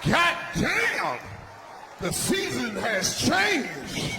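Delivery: comic shocked outburst; mock astonishment. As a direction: play stunned disbelief, stretching each word. Acted shock at changed Atlanta scene.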